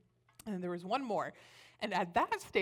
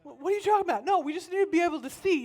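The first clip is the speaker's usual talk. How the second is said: high, loud, flustered denial; defensive protest; 11 semitones higher, 6 dB louder, faster than usual. Retold or imitated. Babysitter denying fear to a child, defensive. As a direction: protest rapidly, pitch jumping up.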